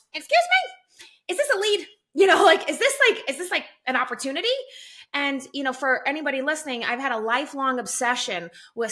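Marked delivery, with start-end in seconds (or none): 0.1-4.5 high, loud, squealing surprise; amazed disbelief. Retold or imitated. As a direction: act thrilled, as if you can't believe your luck. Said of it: "And I'm like".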